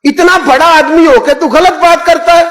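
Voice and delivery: high-pitched mock scolding; scolding pilgrim. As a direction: tell him off from on high. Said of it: "वो हाजी मुझे डांटने लगे". (ऊँची आवाज़ में डांटता हाजी)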